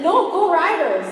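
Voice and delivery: high, loud rival cheer; cheering back. Retold or imitated. An actress cheering her Canadian team at the director. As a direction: shout back for your own team. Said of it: "And I said".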